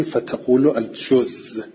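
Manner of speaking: الجز said slowly with a long doubled z at the end; فتقول الجز with the final z held long. Shown as a demonstration. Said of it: "اگر خواستی تشدیدم بخونی".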